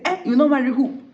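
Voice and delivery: loud, high mock outrage; outraged challenge. Groom refused by his bride, outraged challenge. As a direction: throw the question back indignantly.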